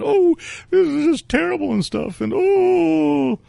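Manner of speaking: high, whiny moaning; mock whimpering lady. Storyteller mocking the lady moaning about guns, high and whiny. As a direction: moan it like a put-upon fusspot.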